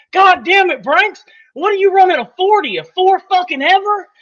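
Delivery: high, loud mock coach yell. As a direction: bark it like an angry football coach. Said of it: "he goes".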